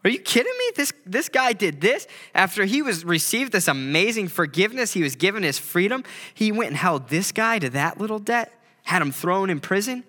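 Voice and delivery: high, incredulous outcry of onlookers, settling down; as if you can't believe what you heard; shocked disbelief. Retold or imitated. Crowd hearing the news, outraged and disbelieving. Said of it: "they're like".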